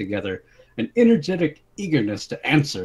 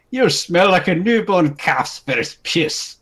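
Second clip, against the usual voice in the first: slow, high, loud sneering insult; angry insult; 8 semitones higher, 5 dB louder, slower than usual. Acted. Short man insulting the princess, slow loud sneer. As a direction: spit the insult slowly. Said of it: "burst at the chance to insult royalty".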